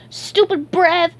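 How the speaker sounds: high, loud, drawn-out taunt; name-calling. A narrator's reading. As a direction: hurl it as a childish insult. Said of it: "SpongeBob name called".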